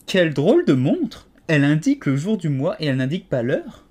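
Alice marvels out loud at the watch; exclaiming. A narrator's reading.

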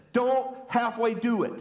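high, emphatic imagined command; audible word from God. Pretend. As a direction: proclaim it like a word from above. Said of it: "the audible word that just said".

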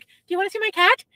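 high, sing-song voice for pets; cat-talk voice. Retold or imitated. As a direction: talk like you're cooing to a cat. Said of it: "Why are you talking this different voice?".

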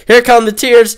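about to bawl, hammed up; mock crybaby. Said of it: "I'm gonna cry".

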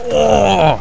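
long, high cry of alarm; startled yell. Real, not acted. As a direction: yell out at a sudden scare. Jump scare during gameplay.

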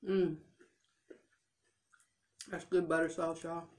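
chewing while talking; muffled through food; mouth full of corn. Real, not acted. Seen 0: corn held at lips, jaw chewing throughout the labelled words.

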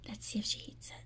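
soft whisper watching the cat; whispered aside. Real, not acted. Owner whispering while the cat sniffs the treat, hushed whisper. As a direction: whisper so the cat stays put.

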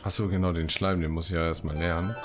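ordinary talk, nothing marked

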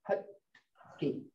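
hutki said softly with a silent D held on the palate; hutki, tongue stopped on the palate before the k; unsounded D. Shown as a demonstration. Teacher showing the hidden D in hutki, unsounded D. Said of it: "you can feel that D sound".

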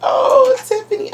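high, tearful cry of gratitude; overwhelmed, voice jumping up near tears; real happy crying, fighting it back; holding back tears. Real, not acted. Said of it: "I'm trying not to cry".

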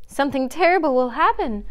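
high-pitched, fearful warning; alarmed cry. A narrator's reading. Faline warning Bambi off the stag, alarmed cry. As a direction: warn them in rising panic. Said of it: "cried Faline".

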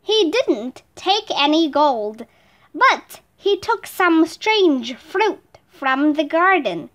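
ordinary delivery

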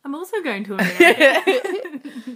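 loud, laughing announcement; laughing excitedly. Real, not acted. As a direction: burst out with it laughing.

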